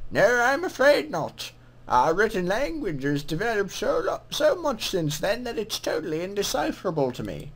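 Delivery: put-on lilting greeting from an old-world scribe; put-on scribe voice, swooping in pitch; scribe's character voice. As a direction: voice a game scribe saying hello. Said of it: "what voice should I give him".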